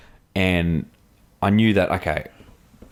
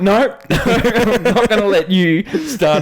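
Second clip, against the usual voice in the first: laughing; 13 semitones higher, 5 dB louder, much slower than usual. Retold or imitated. Retold stubborn refusal, laughing.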